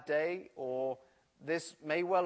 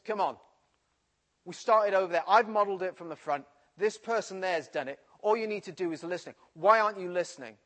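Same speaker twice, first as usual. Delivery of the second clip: irate teacher; 3 semitones higher, 6 dB louder than usual. Retold or imitated. Teacher scolding pupils who struggle with French, irate. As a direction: scold the class like a fed-up teacher. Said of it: "more and more irate".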